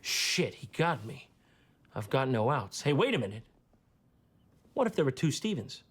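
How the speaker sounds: ordinary talk, nothing marked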